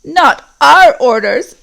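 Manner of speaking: defiant orc. Acted. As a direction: refuse the captain's orders. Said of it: "said one of the earlier voices".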